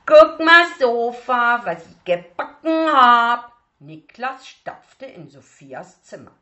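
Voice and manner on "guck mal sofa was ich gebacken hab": put-on loud, much higher child's shout; a proud toddler showing off his cake; excited little boy. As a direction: aufgeregter kleiner Junge. Little boy shows sand cake.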